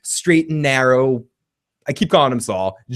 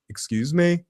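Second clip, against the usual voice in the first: calmer Mike. Retold or imitated. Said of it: "I'm sounding too excitable for Mike".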